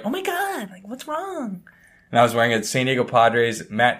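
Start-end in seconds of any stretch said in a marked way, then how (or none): 0.0-1.6 put-on much higher, soft falsetto girly voice; girls' voice. College girls fretting over a kicked ball. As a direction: squeal it up high like a worried girl. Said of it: "the girls that were playing were like".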